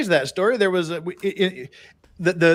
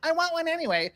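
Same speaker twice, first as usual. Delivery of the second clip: mock girl; 4 dB quieter than usual. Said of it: "I will not be responsible".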